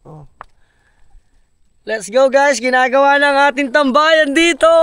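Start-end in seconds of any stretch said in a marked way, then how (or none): 1.8-4.7 excited hype. Real, not acted. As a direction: hype up the crew, stretching the last word. Hyping up viewers about the hangout build. (masiglang panawagan)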